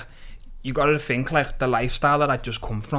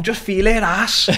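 higher, slow leering urge; a mate pushing him on, higher and slower; mates egging on; 9 semitones higher, 5 dB louder, slower than usual. Retold or imitated.

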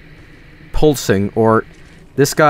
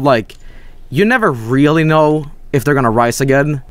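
ordinary talk

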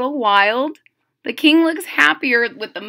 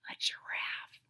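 whisper; 19 dB quieter than usual. Acted. Whispered reveal.

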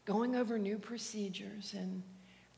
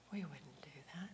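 whisper; 5 semitones lower, 12 dB quieter than usual. Retold or imitated. Quoted refusal whispered.

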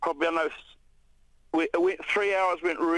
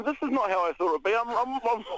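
exasperated disbelief; 7 semitones higher than usual. Retold or imitated. Disbelief at waving rescuers.

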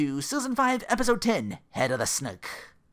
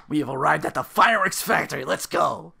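mock announcement; 3 semitones higher, 8 dB louder than usual. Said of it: "He's like".

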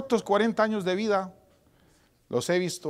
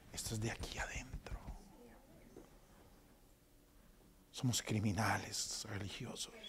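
whisper; 6 semitones lower, 16 dB quieter than usual. Real, not acted. Intimate closing point.

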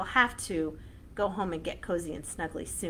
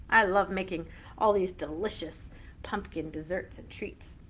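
ordinary talk

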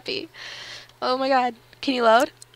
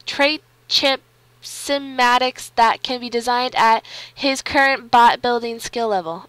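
loud, emphatic on-screen text read; loud game-message read; 10 dB louder than usual. Real, not acted. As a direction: read the pop-up out loudly, like an announcement. Reads game message aloud.

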